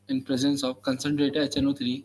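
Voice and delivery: slow, measured dictation; dictating. Shown as a demonstration. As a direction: read it out for students to copy. Dictating notes to students.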